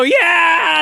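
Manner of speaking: high, loud mock cheer; crowd cheer imitation. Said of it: "The fans go".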